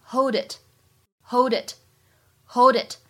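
"hold it" run together, slow; slow demo of "hold it" linked. Shown as a demonstration. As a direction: glide hold into it. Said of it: "前两者可以做连读".

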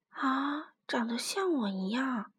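soft, hushed musing; near-whispered question; quietly. A narrator's reading. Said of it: "霸王龙小声地说".

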